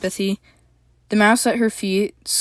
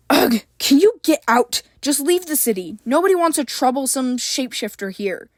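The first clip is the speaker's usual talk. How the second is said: angry bark; 6 semitones higher than usual. A narrator's reading. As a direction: order him out in disgust. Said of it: "April barked".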